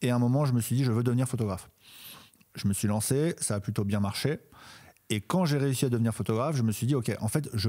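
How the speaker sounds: slight lisp from new braces. Real, not acted. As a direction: talk around sore tongue and braces. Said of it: "un petit zozotement".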